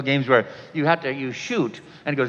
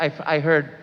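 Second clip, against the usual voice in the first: usual speaking voice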